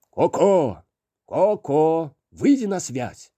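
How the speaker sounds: loud call into the bouquet. Acted. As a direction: call out for Koko. Calling Koko through bouquet.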